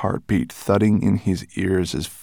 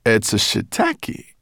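savouring; 3 semitones higher, slower than usual. A narrator's reading. Showing off a prized mushroom.

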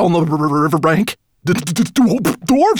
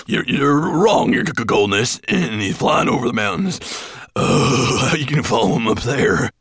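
stuttering dwarf, a little raised; shivering stammer; 4 semitones higher, 4 dB louder than usual. A narrator's reading. Cold dwarf contradicting his master, stammering. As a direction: stammer out a doubt through the cold.

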